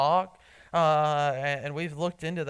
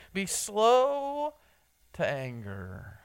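drawn out slowly; 3 semitones higher, much slower than usual. Shown as a demonstration. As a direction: let "slow" hang up high, then ease down. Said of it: "or as he says".

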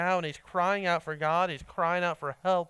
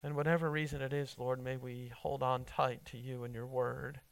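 prayer. Acted. Closing prayer.

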